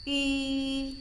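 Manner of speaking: "I" with rounded lips, between i and u; long "I" leaning toward u; fish-eye ü sound. Shown as a demonstration. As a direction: hold "I" high through pouted lips, as ü. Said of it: "agak-agak mau ke I".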